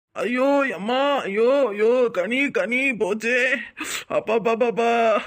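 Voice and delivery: long hammed-up groans of pain; feigned agony. Man performing for the woman who just arrived, fake pained moaning. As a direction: wail as if badly hurt, drawing out each cry.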